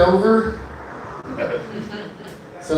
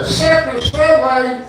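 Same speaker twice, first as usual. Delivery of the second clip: lightly twanged Seffner, said a little higher; 6 semitones higher, slower than usual. Retold or imitated. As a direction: slide into the local accent on Seffner. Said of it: "blow it through your teeth".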